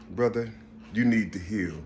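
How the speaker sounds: low, soft imitation of an advisor; imitating hushed, well-meaning advice; retold gentle advice. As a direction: drop low and quiet, as others counsel him. Voicing people advising him to heal.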